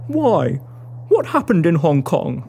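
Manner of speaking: low man's voice; low-pitched, probing question; curious monster hunter. Acted. Walton asks about her past.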